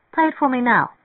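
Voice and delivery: impulsive, excited request; eager demand. Acted. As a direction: jump in with excited insistence. Mary Ann leaps up eagerly.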